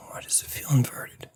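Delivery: whispered to himself; a puzzled whisper under the breath. Real, not acted.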